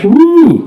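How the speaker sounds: high, loud cartoon whoop; Homer Simpson whoop. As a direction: whoop it like a gleeful cartoon dad. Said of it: "Homer comes back to the house".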